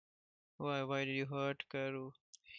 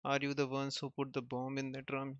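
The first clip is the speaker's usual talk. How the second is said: usual speaking voice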